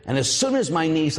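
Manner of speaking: usual speaking voice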